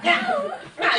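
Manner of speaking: yelling yah while hitting, playfully; half-joking war cry; mock shout of yah. Boys beat off the mad dog, mock shouts. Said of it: "이러고 때렸어".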